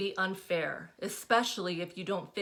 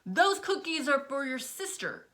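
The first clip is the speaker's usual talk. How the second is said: a higher scolding mom voice; stern reminder, pitched up; scolding mother; 7 semitones higher, 4 dB louder than usual. A narrator's reading. Mother scolding daughter over cookies, stern.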